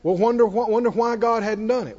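ordinary talk

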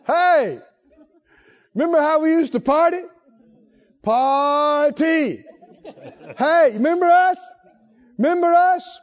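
teasing tempter voice; sing-song temptation call; silly clown. Acted. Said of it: "These demons will roll up".

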